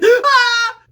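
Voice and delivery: loud, high frightened shriek; hammed-up scream of fear; mock shriek. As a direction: imitate wife panicking. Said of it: "My wife is like".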